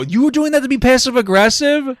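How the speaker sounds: high, loud disbelieving question; husband catching on, stunned and high; real surprise. Real, not acted. Wife reveals garbage tactic.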